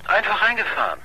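high incredulous question; mock disbelief. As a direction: scoff at the story, pitched high. Reporter doubting the detective's story.

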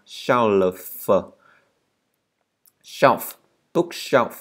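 "shelf" with a long sh and released f; emphatic "shelf" keeping the f ending; clear final F. Shown as a demonstration. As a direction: drill "shelf", then "bookshelf", slowly and separately. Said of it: "Các bạn đừng quên chữ F ở cuối nha".

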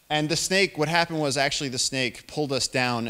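ordinary talk, nothing marked

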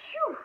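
relieved exhale; phew. Acted. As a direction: blow out in relief. Relief after danger.

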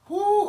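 sudden high whoop of joy; loud ecstatic preacher whoop; joyful whoop. Acted. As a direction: let out a big church whoop. Preacher bursts into celebration.

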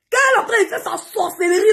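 high, fast, shouted through tears; yelling, close to crying; angry shout. Real, not acted. Angry rant at followers.

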